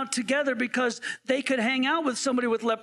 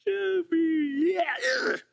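higher mock sobbing sympathy; 5 semitones higher, slower than usual. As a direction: fake a pitying cry, ending in disgust. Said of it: "I'm like".

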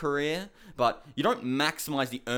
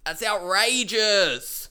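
outraged drawl; 5 semitones higher, 4 dB louder, much slower than usual. Real, not acted. Ranting about her move.

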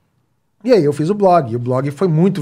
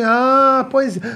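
put-on higher, naive kid accepting; child's voice; 8 semitones higher, much slower than usual. Son convinced by the fruit-market story. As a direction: sound satisfied like a little boy.

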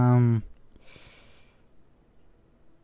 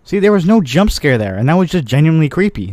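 excited; 4 semitones higher, 6 dB louder than usual. Real, not acted. Reacting loudly to horror game.

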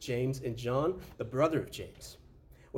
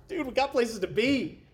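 much higher and slow, exasperated; impatient; 9 semitones higher, 4 dB louder than usual. Retold or imitated. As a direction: whine with impatience. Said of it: "I'm like".

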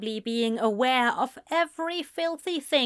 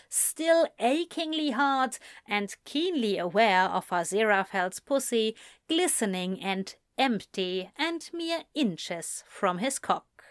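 ordinary delivery

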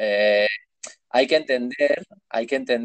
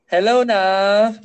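very high, slow Thai English accent; sing-song Thai English greeting, drawn out; imitating Thai English accent for a friend; 12 semitones higher, 3 dB louder, much slower than usual. Shown as a demonstration. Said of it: "A ver cómo sería el acento inglés tailandés".

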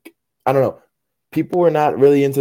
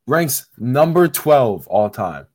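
ordinary talk

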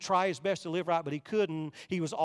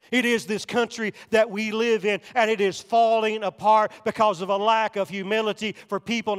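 preaching hard; 3 semitones higher, 8 dB louder than usual. Acted. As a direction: hammer each phrase home to the pews. Preacher rising again.

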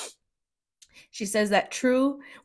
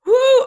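high, loud whoop letting emotion out; real emotional whoop; loud release whoop; 13 dB louder than usual. Real, not acted. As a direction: shake off the tears with a shout. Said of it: "I need to chill for a second".

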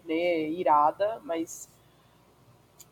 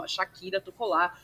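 usual speaking voice

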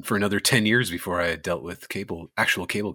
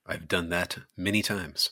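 ordinary delivery

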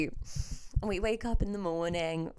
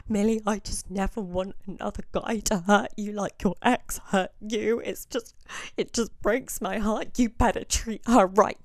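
mock drunk sobbing plea; 4 dB louder than usual. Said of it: "She goes".

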